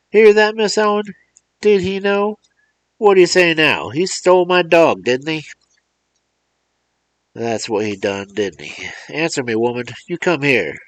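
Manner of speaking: put-on high, loud, furious old man; livid yelling. Old man yelling at the mother and boy, livid and loud. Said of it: "he yelled, his face livid".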